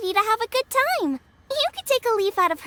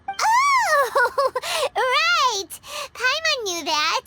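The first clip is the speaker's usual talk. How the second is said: embarrassed laugh; 4 semitones higher, much slower than usual. Acted. Paimon realizing her mistake.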